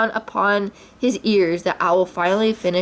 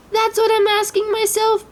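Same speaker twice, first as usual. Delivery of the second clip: much higher, squeaky small animal; a frightened little pig; tiny Piglet voice; 13 semitones higher than usual. A narrator's reading. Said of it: "Piglet said".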